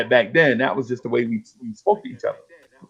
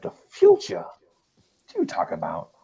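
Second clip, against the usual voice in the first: disbelieving; 3 semitones lower, 4 dB quieter, slower than usual. Retold or imitated. Young self doubting friend's tape.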